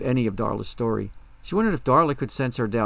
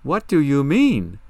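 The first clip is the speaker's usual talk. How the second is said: loud, slow, higher-pitched disbelief; stunned, forceful repeat of a question; incredulous demand; 4 semitones higher, 8 dB louder, slower than usual. Acted. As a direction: demand it with each word drawn out.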